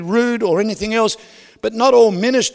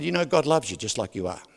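soft tender reassurance; 6 semitones lower, 8 dB quieter than usual. Retold or imitated. Telling a bitter woman God loves her.

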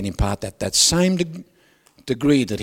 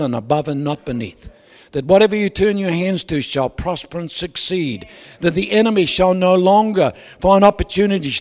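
loud, higher declaring prayer; preaching at full force; 4 semitones higher, 6 dB louder than usual. Acted. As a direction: proclaim the blessing with force. Loud blessing prayer over homes.

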